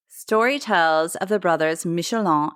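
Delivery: ordinary talk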